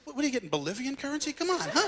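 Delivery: high-pitched, quick exasperation; impatient griping. Acted. Griping at the one guy taking forever, impatient. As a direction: hurry someone along in a rising, fed-up voice. Impatient outburst in bank queue.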